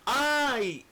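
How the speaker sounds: high, whining sigh of complaint. Retold or imitated. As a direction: moan it like a reluctant servant. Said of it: "también le dice".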